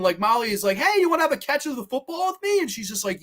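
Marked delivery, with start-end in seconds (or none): 0.8-2.6 much higher, quicker eager voice; friendly invitation. Acted. Molly asking her to play catch, eager high voice. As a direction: chirp it high and fast as Molly.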